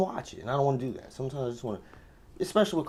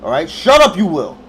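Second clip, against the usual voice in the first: very loud, high, emphatic command; shouted order; 5 semitones higher, 14 dB louder than usual. Retold or imitated. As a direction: shout the threat at the neighbour. Repeats the threat, louder.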